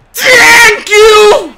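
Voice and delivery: high wailing shout; crying shout. Real, not acted. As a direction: wail it out. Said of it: "repeated shouted".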